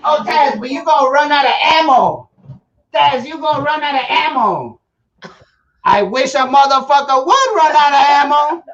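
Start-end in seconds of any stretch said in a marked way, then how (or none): none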